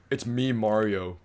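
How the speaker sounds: slow, quiet, unremarkable Mario line; deadpan, low-key Mario; average voice. Acted. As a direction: say the catchphrase softly, with no character at all. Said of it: "he's got a pretty average voice".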